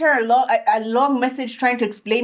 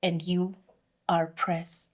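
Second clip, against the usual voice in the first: cold dismissal; 4 semitones lower, 8 dB quieter than usual. Retold or imitated. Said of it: "the tone changed".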